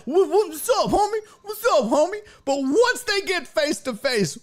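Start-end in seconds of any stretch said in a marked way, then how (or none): 0.1-2.2 high-pitched fake bravado on "homie"; mock tough guy. Mocking the husband who acts tough behind his wife, hammed-up bravado. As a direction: swagger like a man held back by his wife. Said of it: "he's like".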